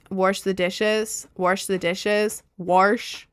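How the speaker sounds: "wash" with an R slipped in, like "warsh"; demonstrating a Southern "warsh"; Southern-style wash. Shown as a demonstration. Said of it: "some words I say more Southerner-y".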